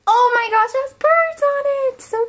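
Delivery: high, loud squeal of delight; thrilled and shrill with joy. Real, not acted. Delighted at a gift.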